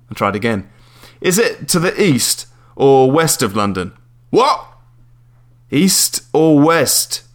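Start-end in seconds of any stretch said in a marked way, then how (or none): 1.2-3.9 slow and higher, each word spaced; slow, overclear question. Acted. Caller trying again with the landlady, slow and careful. Repeating to a deaf listener. 4.3-4.7 aggressive Cockney. Acted. Cockney landlady not listening, aggressive. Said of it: "aggressively Cockney". 5.7-7.2 very slow, louder question. Acted. Caller boiling it down for the landlady, very slow. Repeating to a deaf listener.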